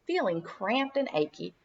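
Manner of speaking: ordinary delivery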